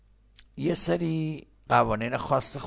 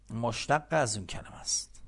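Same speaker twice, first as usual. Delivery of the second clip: ordinary talk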